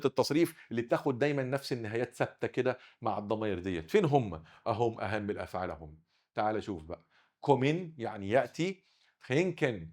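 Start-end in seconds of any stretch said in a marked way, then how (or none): none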